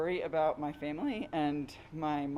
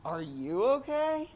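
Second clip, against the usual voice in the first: higher, louder, slow anxious repeat; worried plea; 7 semitones higher, 4 dB louder, slower than usual. Retold or imitated. Child asking her sick mother again, slow and anxious. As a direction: ask again, pleading for an answer.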